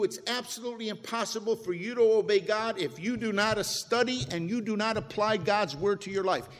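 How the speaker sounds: ordinary delivery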